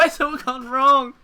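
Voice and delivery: laughing through the line, slowed. Real, not acted. Gamer laughs at his own silly panic, slowly. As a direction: let a laugh break the words.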